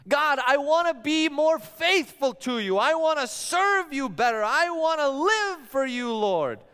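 mock earnest believer praying to God; earnest prayer.

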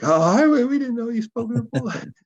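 high-pitched imitation of startled people; gasping surprise. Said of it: "They gasped".

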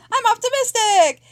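high, bright-voiced cheer; chirpy optimism. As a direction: declare it with overeager glee. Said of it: "super optimistic mode".

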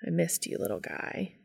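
hushed, mildly tender; gentle, understated fondness; soft admission. A narrator's reading. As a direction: admit it quietly. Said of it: "Cass admitted".

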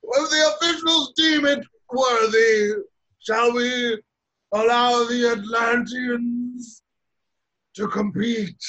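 high, grand announcer's call, slowing down; showman's proclamation. Acted. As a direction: proclaim it like a showman working a crowd. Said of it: "and ever the showman".

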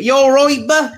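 put-on high, loud old Norfolk greeting in a broad Norfolk accent. Host mimics older Norfolk fans in a Norfolk accent. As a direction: do the broad Norfolk "You all right, bah?". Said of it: "proper Norfolk accent".

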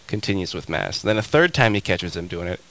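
ordinary talk, nothing marked

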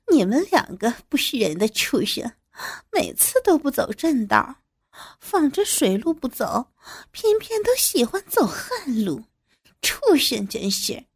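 laughing. A narrator's reading. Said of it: "笑着说".

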